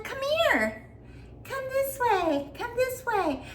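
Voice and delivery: put-on very high, slow, luring child voice; creepy little boy. Mimicking the boy from her short, very high creepy lure. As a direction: coax them in like a creepy kid from a horror short. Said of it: "he looks kinda creepy".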